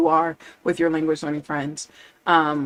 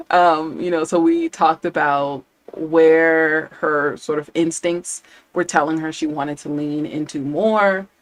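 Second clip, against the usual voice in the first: ordinary delivery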